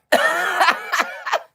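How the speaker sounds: loud, high burst of laughter; big high guffaw; cackling. Real, not acted. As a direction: cackle at your own impression. Laughs at own impression.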